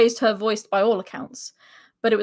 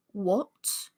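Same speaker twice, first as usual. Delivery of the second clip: curt, icy single word; cold and sharp; 8 dB quieter than usual. Acted. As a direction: snap it coldly, barely voiced. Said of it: "The woman's voice was cold and sharp".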